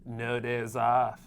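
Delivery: slow, drawn-out L.A. guy voice; Mohira impression. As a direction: stretch it out like a mock Hollywood bro. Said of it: "I'm gonna start voicing all of his captions".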